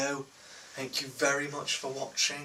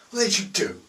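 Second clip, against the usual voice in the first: same high, loud outrage again; furious boss, still yelling; angry dealer repeat; 5 semitones higher, 6 dB louder, faster than usual. Retold or imitated. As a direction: hammer the question home. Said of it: "they could be swearing".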